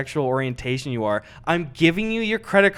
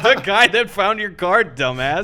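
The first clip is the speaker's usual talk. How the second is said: louder, higher mock indignant jab; mock indignant retort; 3 semitones higher, 4 dB louder than usual. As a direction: play the rude finder snapping back. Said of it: "He's like".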